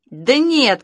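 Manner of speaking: a loud high-pitched objection; shouting. A narrator's reading. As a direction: shout it across the street. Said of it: "кричали они на всю улицу".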